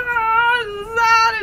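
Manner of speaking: high, loud pretend bawling; mock sobbing. Storyteller playing the second guy on safari, hammed-up sobbing. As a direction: bawl it out, overdone and high. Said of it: "starts, like, sobbing".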